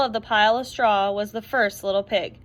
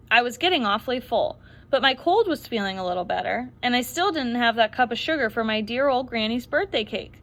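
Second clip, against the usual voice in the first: plain reading voice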